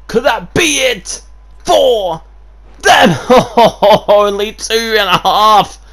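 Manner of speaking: high, loud, laughing near-fall call; can't believe the kickout; excited disbelief. Real, not acted.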